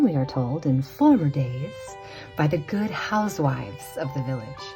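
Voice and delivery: ordinary delivery, nothing marked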